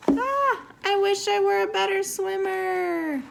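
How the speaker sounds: high, wishful little narwhal sighing; wistful Kelp voice. Acted. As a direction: sigh it out like a small sea creature longing to swim well. Kelp's wish, voiced character.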